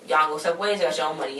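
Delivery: normal talking voice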